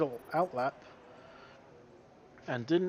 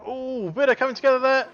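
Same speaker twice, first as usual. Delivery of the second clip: rising ooh at a collision; excited; 6 semitones higher, 5 dB louder than usual. Real, not acted. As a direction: react to the crash. A crash during the live race.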